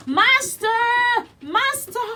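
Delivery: very high, loud alarmed call; harp calling out. Acted. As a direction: cry out to wake the master. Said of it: "the harp called out".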